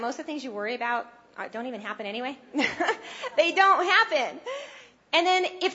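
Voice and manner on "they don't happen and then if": loud, high, amused delivery; laugh, then say it loudly through amusement; real laugh breaking into the words. Real, not acted. Laughs at needless worrying.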